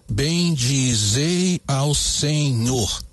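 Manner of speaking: very slow, every syllable spaced out; measured scansion read; syllable counting. Shown as a demonstration. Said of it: "Vamos contar essas sílabas".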